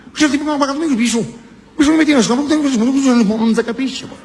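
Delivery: high, fast Venetian mafioso; hammed-up Veneto gangster; Venetian accent. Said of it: "A uno del Veneto".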